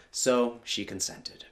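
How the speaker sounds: ordinary delivery, nothing marked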